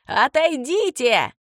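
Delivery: slow, high drawn-out yell; bellow-style order, stretched out; yelled command. A narrator's reading. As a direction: yell it long to drive them back. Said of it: "завопил Дэн".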